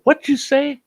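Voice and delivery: high, loud mock question to the goose; playful high-pitched what you say; cupping an ear. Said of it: "and I'd go".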